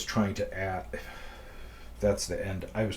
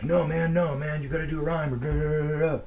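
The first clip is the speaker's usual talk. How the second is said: excited; 7 semitones higher, faster than usual. Retold or imitated. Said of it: "And he's like".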